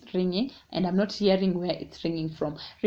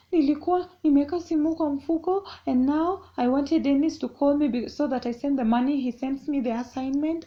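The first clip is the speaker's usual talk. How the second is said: nervous pleading; 7 semitones higher, 3 dB louder than usual. Retold or imitated. Scared daughter explaining to mom.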